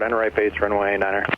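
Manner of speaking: ordinary talk, nothing marked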